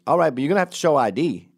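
imitating a firm gatekeeper, fast and loud; mock firm demand. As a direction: lay down the rule quickly and loudly. Acted woman demanding ID.

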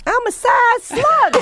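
slow, very high goofy voice; silly high voice. Pretend. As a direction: stretch it out, squeaky. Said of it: "silly high slow voice".

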